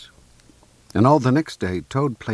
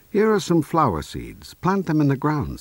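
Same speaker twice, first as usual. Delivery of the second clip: lightly voiced friendly Frog, higher pitch; 8 semitones higher, 3 dB louder than usual. A narrator's reading. Frog handing Toad some flower seeds, lightly voiced higher. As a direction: raise the pitch a little for Frog's lines.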